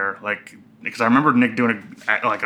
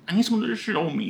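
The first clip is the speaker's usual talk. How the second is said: high, soft whine; whining; 9 semitones higher, 5 dB quieter than usual. Retold or imitated. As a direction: whimper it like a helpless man. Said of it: "you're whining in your car".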